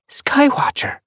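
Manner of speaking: high, breath-caught exclamation; awed recognition. A narrator's reading. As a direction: call the name out in sudden wonder. Sees a dead friend's spirit.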